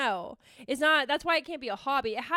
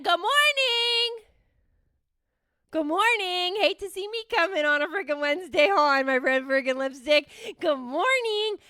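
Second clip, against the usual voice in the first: showy greeting; 5 semitones higher, 5 dB louder than usual. Pretend. Host coming on Wednesday in red lipstick, showy greeting. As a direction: call it out like a grand entrance.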